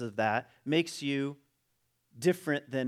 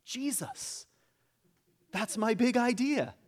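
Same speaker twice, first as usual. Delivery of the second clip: high comic voice having a bright idea; God; 7 semitones higher than usual. Retold or imitated. Deity announces a long-awaited idea, high mock-excited voice. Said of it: "And then God was like".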